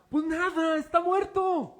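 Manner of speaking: high, slow mock amazement; exaggerated exclamation. Preacher exclaims to congregation, exaggerated. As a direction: throw it up high like the obvious punchline. Preacher dramatizes dead listener.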